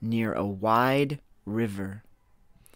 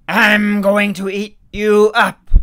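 much louder, higher monster bellow; troll shout; 9 semitones higher, 11 dB louder than usual. Acted. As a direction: roar the threat as a troll.

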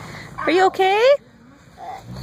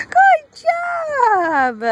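excited cheer; 10 semitones higher than usual. Real, not acted. Cheering toddler on playground.

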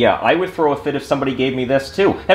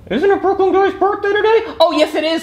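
much higher, sing-song helper voice; helpful voice; 4 dB louder than usual. Acted. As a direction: chirp in like a helpful stranger. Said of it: "helpful voice that's more considerate".

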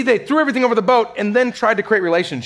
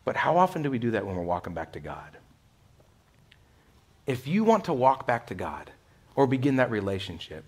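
ordinary talk, nothing marked

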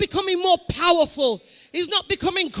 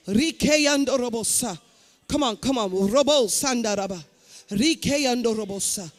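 speaking in tongues; 6 semitones lower than usual. Real, not acted. Preacher prays in tongues over the congregation.

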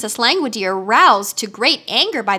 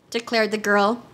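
usual speaking voice

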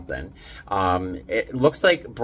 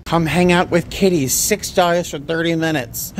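excited sign reading; 6 semitones higher, 3 dB louder than usual. Real, not acted. Reads cat lounge offer, delighted.

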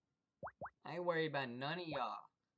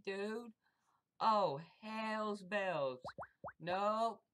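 ordinary talk, nothing marked

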